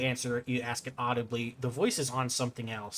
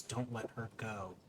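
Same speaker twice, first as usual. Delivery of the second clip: eerie inner voice; 12 dB quieter than usual. Acted. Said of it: "the voice does too and says".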